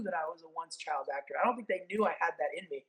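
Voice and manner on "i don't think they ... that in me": normal talking voice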